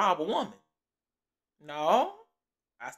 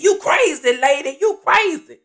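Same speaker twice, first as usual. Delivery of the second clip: much higher, much louder shocked yell; imitating the robber; 9 semitones higher, 13 dB louder, slower than usual. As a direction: squeal it out like he's scared of you. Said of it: "Dude said".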